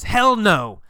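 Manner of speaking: high, loud, falling refusal; emphatic refusal. Acted. Gabriel nixes Kathy's idea to study English, emphatic refusal. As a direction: shoot it down firmly and loudly.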